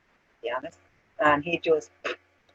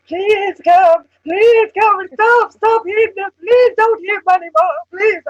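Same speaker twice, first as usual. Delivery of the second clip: high, loud, wailing mock plea of crying women; 13 semitones higher, 11 dB louder, slower than usual. Mocking the crying women begging him to stop, high wailing plea. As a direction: wail it like two sobbing girls begging.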